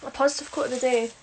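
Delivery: ordinary delivery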